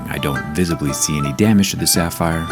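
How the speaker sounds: ordinary talk, nothing marked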